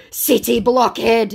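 high, loud, drawn-out mock insult; hammed-up angry name-calling; loud scammer retort. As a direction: shout it slowly as the scammer. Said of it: "your eardrums going".

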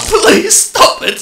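high, loud, slow begging cry; desperate pleading. Acted. Witness begging his torturer to stop, desperate cry. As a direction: beg him to stop, terrified.

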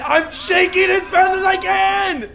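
high, loud and drawn out; frantic shout. Real, not acted. Gamer straining to shake the controller fast enough, frantic shout. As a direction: yell it while shaking with all your might.

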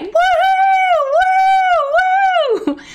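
very high, loud woo-hoo; cheering whoop. Pretend. Leader getting children to shout, whooping high. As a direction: whoop it up for the crowd. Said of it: "told the people to shout".

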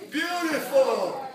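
slow, loud, stretched-out compliment; drawn-out praise. Real, not acted. Coach praising student's stance, loud drawn-out cheer. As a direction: stretch the word out loudly in approval.

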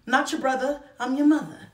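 put-on a playful mom scolding her teen; sassy mom comeback. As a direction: put the kid in their place, playfully. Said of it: "that's my favorite thing to say".

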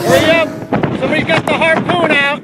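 shouting. Real, not acted. Yelling teasing at gym.

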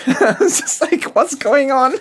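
high, loud, laughing disbelief. Real, not acted. Guest telling host how money vanished, laughing. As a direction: crack up through the words as the pitch shoots up.

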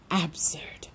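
dismissive whisper; whispered scorn. Acted. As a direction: breathe it out with contempt. Mother scoffs at nuns.

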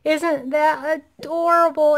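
high, slow gushing delight; excited gushing. Real, not acted. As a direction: swoon over it slowly. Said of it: "what I'm so excited about".

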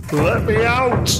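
high, slow, desperate shout; yelling for help while hammering the door; panicked plea. A narrator's reading. Doctor hammering on the door, panicked. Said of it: "hammered against it in panic".

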